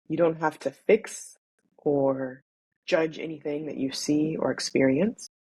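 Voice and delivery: measured, soothing body-scan guidance; guided meditation. Acted. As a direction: ease them into noticing their breath, unhurried.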